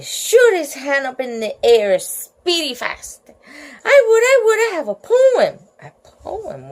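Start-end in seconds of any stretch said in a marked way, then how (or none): 3.8-5.5 high, loud, eager schoolkid; excited child volunteering at high pitch; eager pupil. Acted. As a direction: burst out, desperate to be picked.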